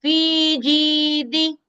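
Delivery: फी जी दी with long फी and जी, short दी; slow chant of फी जी दी; madd length. Shown as a demonstration. Teacher recites फी जी दी for the class, chanted. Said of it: "फी में या मद्दा दो हरकत".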